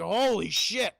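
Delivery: loud, stunned exclamation; acted shock. Stepson sees his stepfather die right there, shocked. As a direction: exclaim in mock disbelief. Acted reaction to sudden death.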